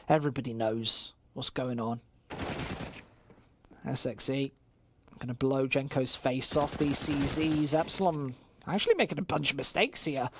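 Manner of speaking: ordinary talk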